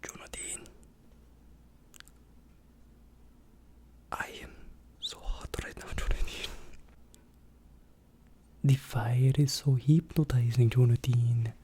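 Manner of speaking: breathy whispered murmur; asmr whisper. Acted. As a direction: whisper into the ear.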